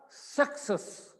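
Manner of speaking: सक्सेस whispered slowly, unvoiced. Shown as a demonstration. Teacher modelling the word after a wrong answer, whispered. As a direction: draw out सक्सेस in a breathy whisper. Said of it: "सक्सेस wrong".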